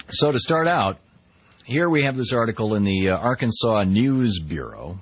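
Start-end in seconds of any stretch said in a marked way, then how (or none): none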